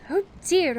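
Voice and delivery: high, loud exasperated cry. Acted. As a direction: throw up your hands. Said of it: "cried Wendy".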